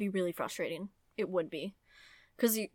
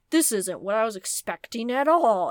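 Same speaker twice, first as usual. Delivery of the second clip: mock indignant reader; 2 semitones higher, 10 dB louder than usual. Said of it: "they're like".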